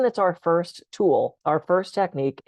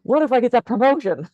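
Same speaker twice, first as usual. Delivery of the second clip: much higher and louder, in bright pretend eagerness; mock hopeful excitement; 8 semitones higher, 4 dB louder than usual. Joking to the audience about never worrying over good things, mock excited. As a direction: play the absurdly hopeful late-night thought. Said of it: "We don't wake out of a dead sleep and go".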